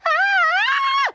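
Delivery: loud high-pitched frightened shriek; scream. A narrator's reading. Jellyfish sees toothy monster, high scream. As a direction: scream at full height in sudden fright. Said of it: "she screamed".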